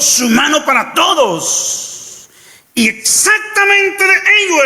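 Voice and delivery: rising sermon fervour, near shouting; preaching at full force. Acted. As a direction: preach it out to the congregation.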